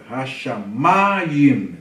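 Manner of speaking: Hashamayim drawn out slowly with a long, strong MA; stressed syllable shown. Shown as a demonstration. Teacher pointing out tonic syllable, Hashamayim stressed on a stretched MA. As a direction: lean hard on the MA in Hashamayim, slowly. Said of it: "Ó a sílaba tônica".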